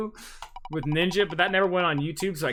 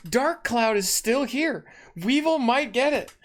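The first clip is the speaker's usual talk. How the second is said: excited hope; 8 semitones higher than usual. Real, not acted. Hoping wheel lands on game.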